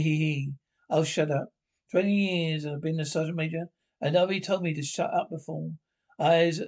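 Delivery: usual speaking voice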